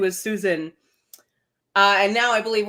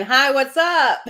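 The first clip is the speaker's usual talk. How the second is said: louder, higher mock-chirpy hello; perky greeting; 6 semitones higher, 7 dB louder, slower than usual. Host reading a viewer's greeting to the audience, perky. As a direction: belt out the viewer's greeting, pitched up.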